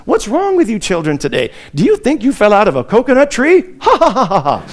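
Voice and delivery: quoting the coconut tree line, ending in a cackling laugh; light mock voice that breaks into a bright laugh; Kamala Harris quote. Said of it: "when she said things like".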